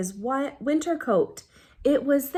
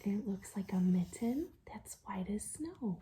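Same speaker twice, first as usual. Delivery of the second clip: whisper; 3 semitones lower, 13 dB quieter than usual. Real, not acted. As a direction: lean in and whisper the secret. Whispered aside to listening children.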